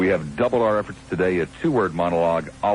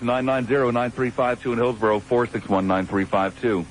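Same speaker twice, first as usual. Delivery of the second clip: normal talking voice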